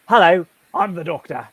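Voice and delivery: English accent, in character as the Time Lord; English-accented Doctor voice, a touch grand; the Doctor's English accent. Shown as a demonstration. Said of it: "I'd like to do my Doctor Who accent for you".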